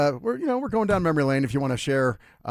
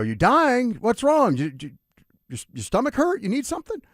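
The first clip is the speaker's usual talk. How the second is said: alarmed; 12 semitones higher, 4 dB louder than usual. Retold or imitated. Grandson alarmed at grandpa's claim.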